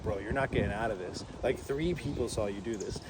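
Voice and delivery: low, hushed bro voice; mock-grave warning, low and soft; imitating rowdy passenger. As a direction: drop into a quiet slacker voice. Imitates Ricky's warning to driver.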